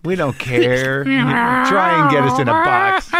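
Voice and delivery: high, comic cat voice; hammed-up high-pitched feline defiance; defiant cat. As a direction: play a cat daring its owner. Voices defiant cats refusing rescue.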